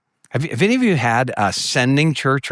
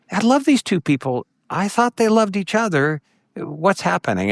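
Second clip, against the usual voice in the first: higher, pleading bewilderment; pained disbelief; 8 semitones higher than usual. Retold or imitated. Pastor watching friends fight, high pained disbelief. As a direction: ask it as if heartbroken, pitched up.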